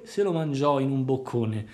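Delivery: ordinary delivery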